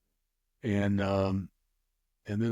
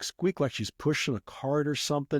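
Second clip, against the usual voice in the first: ordinary delivery, nothing marked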